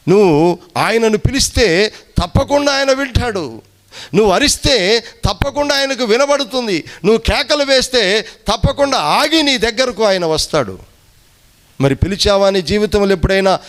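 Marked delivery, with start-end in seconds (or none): none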